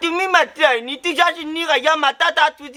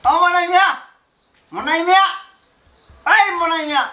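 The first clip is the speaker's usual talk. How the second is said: calling out. Acted. Man summoning Monai Mia, calling out. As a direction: call the name out, stretching the end.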